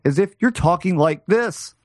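odd, lifted inflection on "you're talking like this"; wrong-syllable emphasis. Shown as a demonstration. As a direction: misplace the emphasis through "talking like this". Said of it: "the emphasis was always on the wrong syllables".

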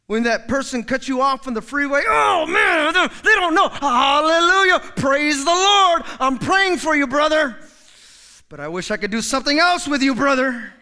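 loud, strained holy talk through anger; mock pious road rage. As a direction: shout praise while fuming at the driver. Mock pious driver cut off.

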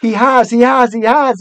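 high, loud, excited squeal; squeaking squirrel. A narrator's reading. Young squirrel losing its head completely, squeaking. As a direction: blurt it repeatedly as a frantic little squirrel. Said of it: "it squeaked".

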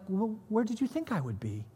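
high, soft, innocent puzzlement; softly naive, blameless question. Acted. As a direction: ask it guilelessly, lightly and quietly. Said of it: "almost this naive kind of like".

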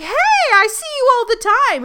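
high, loud, quick mock hello; mock overfamiliar greeting. Cinderella greeting regulars she supposedly knows, mock overfamiliar. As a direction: imitate an overeager friendly greeting.

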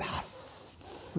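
snake hiss; long, soft voiceless hiss; Sir Hiss. Acted. Snake adviser about to answer the prince, hissing. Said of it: "like the snake would".

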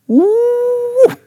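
long, high woo; excited whoop. Real, not acted. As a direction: let out a high, drawn-out woo. Preacher exclaims over the scripture.

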